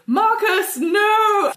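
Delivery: high shouted plea; Bertrand's cry. Acted. Bertrand crying out to Marcus as he falls. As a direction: cry out his name as he dies. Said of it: "Bertrand go".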